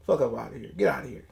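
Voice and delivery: ordinary delivery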